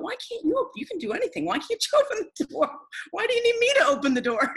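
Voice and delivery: baffled that the all-powerful one needs help; exasperated disbelief. Retold or imitated. Exasperated thoughts at Jesus.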